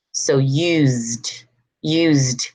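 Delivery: "used" as yoozd, one syllable; final D sound. Shown as a demonstration. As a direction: let "used" end on a voiced D. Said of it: "as a D".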